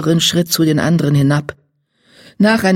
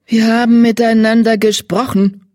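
higher, curt reply; brusque; 6 semitones higher, 4 dB louder than usual. A narrator's reading. Salbeibart answers her former pupil Gelbzahn, brusque. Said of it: "antwortete Salbeibart schroff".

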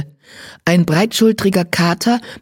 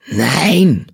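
put-on an old cat's hoarse croaked no. A narrator's reading. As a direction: croak it out of the shadows. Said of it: "Das heisere Krächzen aus dem Schatten". (heiseres Krächzen eines alten Katers)

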